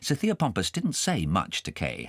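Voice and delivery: usual speaking voice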